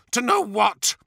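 high, scornful demand; venomous interruption; spat in anger. A narrator's reading. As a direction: spit the question back at him. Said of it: "spat Fulgrim".

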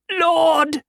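very high, loud cry to the sky; raging at the heavens. Acted. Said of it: "he cried to the sky".

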